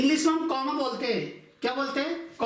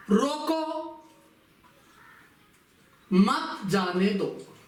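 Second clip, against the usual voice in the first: रोको on its own, long pause, then मत जाने दो; pause after रोको; slower than usual. Shown as a demonstration. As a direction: break after रोको, start fresh on a strong मत. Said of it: "रोको के बाद में लगा दिया".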